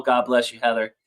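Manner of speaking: normal talking voice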